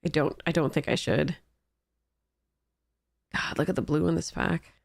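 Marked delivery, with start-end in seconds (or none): none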